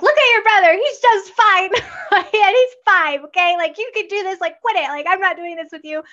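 high-pitched parental nagging, laughing partway through; mock exasperated parent. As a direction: imitate yourself throwing it in your kid's face. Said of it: "throwing it in their face, which I'm prone to do".

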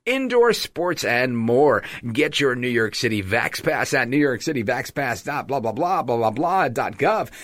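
hammed-up ad pitch with swooping pitch; mock radio announcer. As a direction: sell it like the best thing ever. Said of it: "like it's the best thing in the world".

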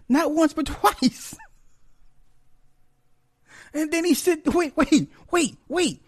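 very high, laugh-strained telling; squeaking out the story between laughs; breaking up with laughter. Real, not acted. Still laughing while retelling.

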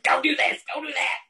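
high, put-on nasty old woman voice; hammed-up villainous mother; mock tyrant mother. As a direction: caricature a domineering mother barking orders. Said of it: "with that great accent".

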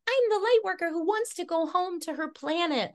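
high, airy-fairy self-parody; mock lightworker. Mocking her old longing for home, high parody voice. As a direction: put on a high, dreamy lightworker voice.

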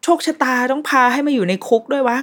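high, protesting "why me" wail, lightly acted; voiced self-pitying complaint. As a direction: act out someone bemoaning their fate. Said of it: "ถ้ายังจมอยู่กับความรู้สึกว่า".

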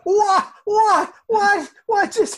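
high, loud repeated what; shocked. Acted. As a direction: blurt it out in disbelief. Reacting to the surprise attack.

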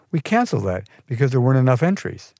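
breezy lilt with swinging pitch; sing-song it, pitch swooping up and down. A narrator's reading. Receptionist telling applicant it was canceled, in a sing-song voice. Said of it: "she said in a sing-song voice".

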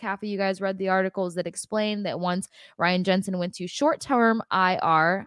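ordinary talk, nothing marked

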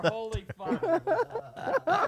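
choking, strained and slow. Real, not acted. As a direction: gasp it out while choking. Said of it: "I'm choking to death over here".

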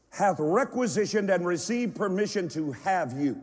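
usual speaking voice

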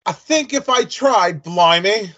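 put-on slipping English accent. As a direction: try to hold the English accent and lose it. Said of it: "Nah, I, I lost it".

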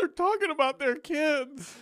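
high, slow mock-weepy gushing; tearful listener. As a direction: gush as if overcome, in a high voice. Acting out tearful podcast listener.

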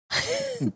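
a sudden high-pitched burst of laughter; high squealing laugh. Real, not acted. Host reacting to the title, high laugh.

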